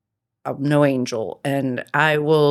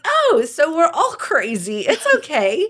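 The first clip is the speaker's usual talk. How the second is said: high, giggly mock reassurance; laughing reassurance; 11 semitones higher, 3 dB louder than usual. Shrugging off friends' crazy stories, high and giggling. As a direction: laugh it off like it's nothing. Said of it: "And I'm like".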